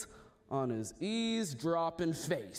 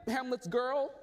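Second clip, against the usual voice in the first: ordinary delivery, nothing marked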